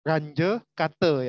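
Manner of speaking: Ranje Kate very slowly, as "RAN-juh KAH-tuh"; Ranje Kate pronunciation demo. Shown as a demonstration. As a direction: spell out Ranje Kate by ear, word by word. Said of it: "Jadi cara bacanya ini adalah".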